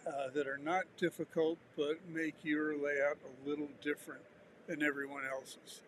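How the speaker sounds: ordinary talk, nothing marked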